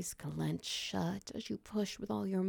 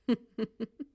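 flirty laugh; 4 semitones higher, 6 dB louder than usual. Real, not acted. Flirty laugh after request.

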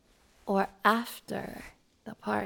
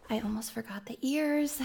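hushed reminder to herself; whisper; 5 dB quieter than usual. Acted. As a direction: whisper it to yourself. Alone, getting ready.

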